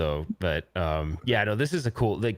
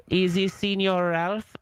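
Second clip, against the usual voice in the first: high, slow put-on voice with a foreign twang; disguised caller voice; 8 semitones higher, slower than usual. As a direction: play a prank caller faking a stranger's voice. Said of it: "such a good job disguising it".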